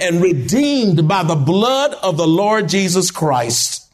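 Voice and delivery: normal talking voice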